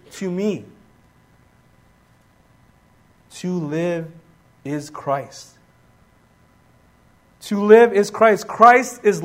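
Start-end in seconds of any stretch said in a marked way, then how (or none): 0.1-5.6 low, soft, with long pauses between phrases; quiet solemn declaration. Acted. Preacher as Paul stating what life is, quiet and solemn. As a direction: let each phrase settle quietly. Paul's reason, spoken softly with pauses.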